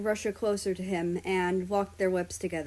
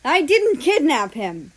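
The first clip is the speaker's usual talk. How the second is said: loud, high denial; shout; 6 semitones higher, 10 dB louder than usual. Acted. Germany yelling at the police while trying to escape. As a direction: yell it to break free. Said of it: "Germany shouted".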